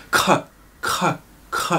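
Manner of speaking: breathy, unvoiced "k"; "k" soufflé; whispered kh sound. Shown as a demonstration. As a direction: hiss out the "k" sound. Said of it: "Le K, qui est avec un H".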